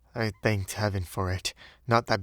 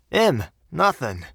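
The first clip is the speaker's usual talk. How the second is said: higher, emphatic Cockney bellboy; Cockney dialect; 4 semitones higher, 5 dB louder, slower than usual. A narrator's reading. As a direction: answer dismissively in Cockney. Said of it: "Cockney dialect".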